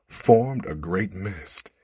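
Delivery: ordinary delivery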